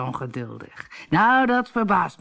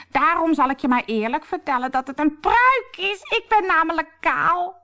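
high mock-bride confession; squeaky disguise voice; 13 semitones higher, 3 dB louder than usual. Harlekijn in disguise admits to a wig, squeaky voice. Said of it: "Ik piep zo".

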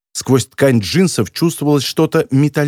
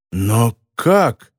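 bewildered; 3 semitones lower than usual. A narrator's reading. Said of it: "недоуменно спросил Моретти".

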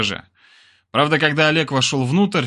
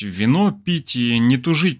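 plain reading voice

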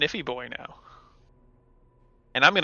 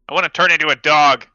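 fast, loud frustrated whine; 3 semitones higher, 10 dB louder, faster than usual. Real, not acted. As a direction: complain loudly, almost a wail. Whining at unhelpful spells.

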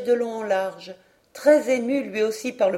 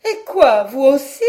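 loud, higher, stunned outcry; astonished cry; 4 semitones higher, 9 dB louder than usual. A narrator's reading. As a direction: blurt it out in disbelief. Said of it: "s'écria madame Caudal abasourdie". (s'écrier, abasourdie)

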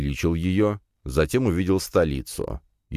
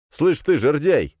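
high, drawn-out rough challenge; rude; 10 semitones higher, 4 dB louder, slower than usual. A narrator's reading. Hero to fat mage, rude challenge. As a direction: call out insultingly, spoiling for a fight. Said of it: "грубо обратился я".

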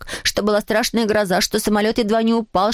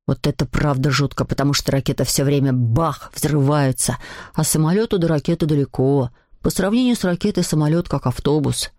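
much lower know-it-all voice; opinionated schoolboy; 7 semitones lower than usual. A narrator's reading. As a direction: argue the point in a low boy's voice. Voices schoolboy Maixent arguing.

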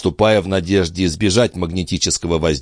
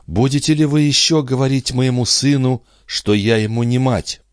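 higher-pitched female character, reproachful; wounded mother; 7 semitones higher than usual. Acted. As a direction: challenge him in a lifted voice. Андре отвечает во сне. (уязвлённая мать, повышенным голосом)

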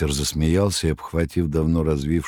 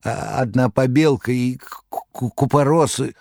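high stammering babble; babbling in terror; 8 semitones higher than usual. Acted. Magarych babbling in terror, high stammer. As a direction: stutter out nonsense in fear. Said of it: "в ужасе понёс какую-то околесину".

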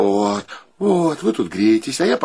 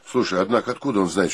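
ordinary talk, nothing marked

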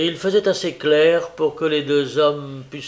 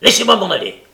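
loud, higher angry order; furious demand; 5 semitones higher, 9 dB louder, faster than usual. A narrator's reading. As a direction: shout to be released. Sykes breaks free in fury.

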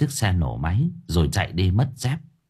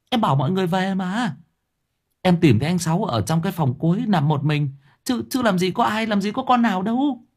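high, whiny voice climbing higher; protesting sidekick; 9 semitones higher than usual. A narrator's reading. Quang denying he brought anyone to boss, protesting. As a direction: plead innocence in a high pitch.

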